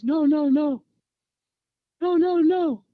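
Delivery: high, loud wailed refusals; mock panicked protest. Tortured captive to his attackers, mock panicked wail. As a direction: imitate a victim crying out in terror.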